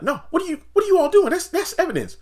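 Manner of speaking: very high, loud shrieking imitation; hammed-up screeching Nancy; mock Nancy yelling. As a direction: shriek in outrage over the mess. Voicing Nancy yelling at them.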